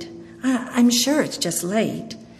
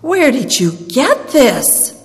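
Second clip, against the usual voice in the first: high, loud stunned question; breathless shock; 6 semitones higher, 9 dB louder, slower than usual. A narrator's reading. Old lady asks the boy where the tapestry came from, stunned. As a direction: ask it slow, shaken by the sight. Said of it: "she asked breathlessly".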